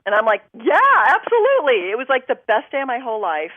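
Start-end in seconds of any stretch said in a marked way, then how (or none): 0.5-1.9 loud, high, bursting with joy; thrilled yes. Retold or imitated. Told she got a theater part, she accepts with loud excitement. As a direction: blurt the yes out, overjoyed.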